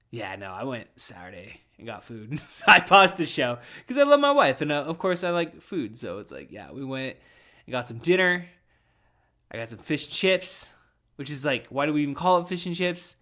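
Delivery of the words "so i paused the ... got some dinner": normal talking voice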